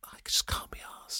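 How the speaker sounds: voiceless confession under the breath; whispered admission. Real, not acted. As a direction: whisper it like a guilty secret. Admits laziness, whispered.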